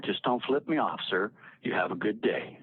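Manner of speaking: ordinary talk, nothing marked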